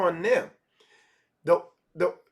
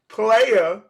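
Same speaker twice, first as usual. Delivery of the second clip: ordinary delivery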